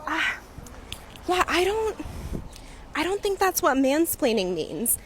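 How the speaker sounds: high, hesitant mock disagreement; doubtful mansplainer. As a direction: imitate a date hedging before correcting her. Imitating date disputing the definition.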